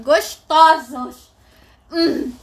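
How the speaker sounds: loud, slow admiring shout. Real, not acted. Reader admiring the couple, shouting slowly. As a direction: belt it out in delight.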